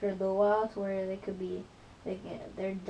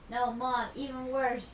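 normal talking voice

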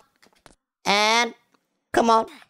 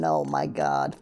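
ordinary talk

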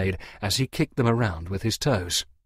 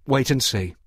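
ordinary delivery, nothing marked

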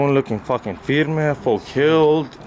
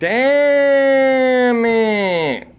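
long, high, loud "Damn it"; drawn-out shout; 8 semitones higher, 4 dB louder than usual. Pretend. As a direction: stretch "Damn it" out in a big yell. Drawn-out exclamation trade.

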